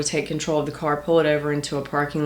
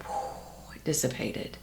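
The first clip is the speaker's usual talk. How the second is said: relieved whisper; 5 semitones lower, 13 dB quieter, slower than usual. Real, not acted. Relief as worry lifts.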